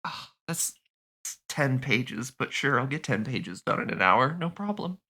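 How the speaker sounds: soft, resigned grumble to oneself; half-hearted, sinking acceptance of a hopeless job; deflated muttering. Acted. Teen alone facing ten pages in an hour, deflated. Teen left with impossible homework.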